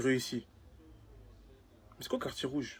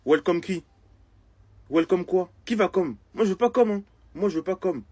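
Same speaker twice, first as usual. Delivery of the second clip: raised, louder protesting voice; indignant refusal; 5 semitones higher, 6 dB louder than usual. Acted. Man rejecting the satanist welcome, indignantly. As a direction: push back, offended and theatrical.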